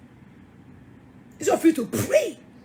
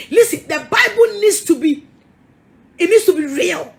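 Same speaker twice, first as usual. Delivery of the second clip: louder, raised pitch, rebuking the congregation; forceful preaching; 3 semitones higher, 7 dB louder than usual. Real, not acted. Preacher scolding pretenders in church, loud forceful rebuke. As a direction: hammer it home from the pulpit.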